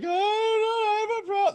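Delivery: high, hammed-up teen whine; fake-sob it again to the parents; mock wailing. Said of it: "I was like".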